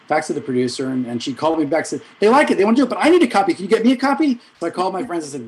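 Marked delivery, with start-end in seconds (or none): 2.2-4.4 high, loud and excited; pitched way up, pressing for a favour; excited urgency. Retold or imitated. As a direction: imitate a friend calling with thrilling news and an urgent request. Quotes friend's excited urgent call back.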